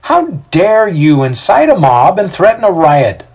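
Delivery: high, loud indignant outburst. A narrator's reading. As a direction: confront the mob, affronted. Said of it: "Titus says indignantly".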